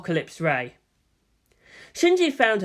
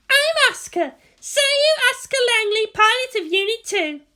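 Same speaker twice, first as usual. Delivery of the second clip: put-on much higher, louder girl's voice; Asuka's voice; 4 dB louder than usual. A narrator's reading. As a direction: size her up haughtily. Asuka introduces herself.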